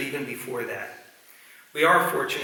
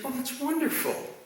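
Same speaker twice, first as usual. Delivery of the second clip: much higher, sing-song praise like a preschool teacher; 12 semitones higher, 4 dB quieter than usual. Retold or imitated. Teacher praising a little boy, high and sing-song. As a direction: pitch it way up to delight the child. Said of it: "And she said".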